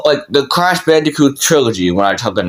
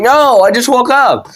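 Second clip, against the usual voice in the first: high, insistent protest; defensive denial; 10 semitones higher than usual. Retold or imitated. Kid accused by mom, protesting defensively. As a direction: imitate a kid pleading innocence. Child denies mom's accusation.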